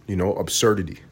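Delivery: ordinary talk, nothing marked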